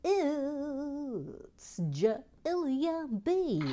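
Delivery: high, drawn-out showman announcing; announcer voice. Acted. Presenter grandly announcing a viewer to the chat. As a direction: build it up like a game show host.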